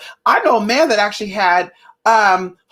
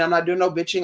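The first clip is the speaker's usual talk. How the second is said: normal talking voice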